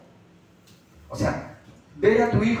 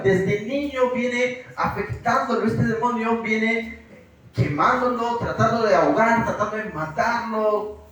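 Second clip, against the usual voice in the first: acted begging, higher and drawn out; desperate tearful plea; 3 semitones higher than usual. Pastor as father begging Jesus for his son, desperate plea. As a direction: plead like a father at the end of his strength. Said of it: "lo diría incluso hasta llorando".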